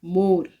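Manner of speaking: मोर with a closed o, not an open oo; teacher modelling the closed o in मोर; waw majhool demo. Shown as a demonstration. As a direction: say मोर with a held-back o. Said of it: "इसमें वाव जो है ये खुलकर सामने नहीं आती है".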